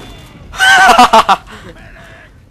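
very high, long laugh; high squealing laugh. Real, not acted. Gamer laughs high and long after a panicked moment.

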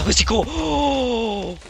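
very high, loud drawn-out cry; startled yelp. Real, not acted. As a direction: cry out in sudden fright. Creeper explodes.

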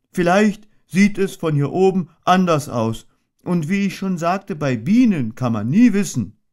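high, slow worried bear voice; a little bear anxiously asking, then shrugging; worried Pooh. A narrator's reading.

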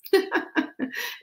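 laugh. Real, not acted. Laughing at her puppy.

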